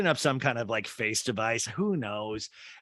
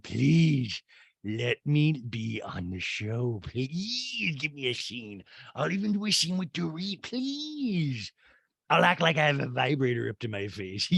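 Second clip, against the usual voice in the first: slow, pleading caricature with a giggle; Rinna; slower than usual. Acted. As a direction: beg with long drawn-out pleases. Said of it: "is that just Rinna telling the producers".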